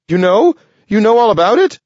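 high, loud disbelieving squeak; astonished squirrel. Acted. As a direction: gasp it out in disbelief.

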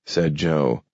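ordinary delivery

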